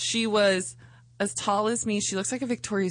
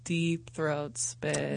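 slow punchline; 6 semitones lower, 6 dB quieter, much slower than usual. Real, not acted. Punchline answer, dramatic.

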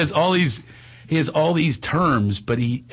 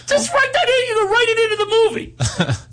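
high squeaky laughing voice, much higher; imitating Steiny; 3 dB louder than usual. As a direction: squeal it out through laughter as the pushy friend. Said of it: "so he goes".

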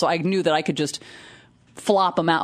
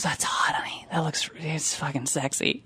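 slow, soft mock whisper; imitated whisper; 3 semitones lower, 7 dB quieter than usual. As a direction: whisper it like her groggy come-on. Retells friend's sultry reply after surgery.

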